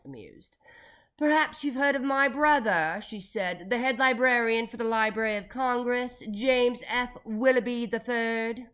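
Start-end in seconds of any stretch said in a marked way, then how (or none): 1.2-3.0 loud, high, haughty lady; grand operatic tone. A narrator's reading. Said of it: "the lady said operatically". 3.7-8.7 loud, grand lady's proclamation; high, projected, stately delivery; operatic. A narrator's reading. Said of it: "the lady said operatically".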